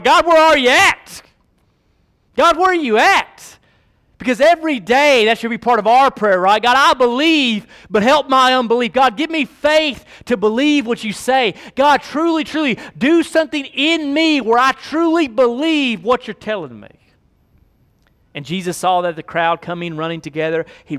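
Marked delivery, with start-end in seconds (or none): 0.0-3.6 much higher, loud anguished plea; mock desperate wail at God; desperate cry to God. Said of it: "we're like". 4.2-16.9 loud, high preaching build; full-force preaching. Acted. Preaching climax, rising intensity.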